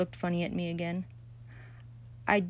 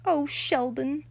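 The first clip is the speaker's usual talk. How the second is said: high, loud, syrupy delight; a pleased girl praising her admirer; delighted gushing; 6 semitones higher, 3 dB louder than usual. A narrator's reading. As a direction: gush over him. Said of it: "Lucille's face beamed very happy".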